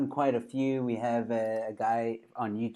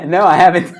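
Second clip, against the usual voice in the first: loud real laughter; laughing loudly; 6 semitones higher, 14 dB louder than usual. Real, not acted. Host laughs loudly at the comedian's teasing question. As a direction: burst out laughing through the denial.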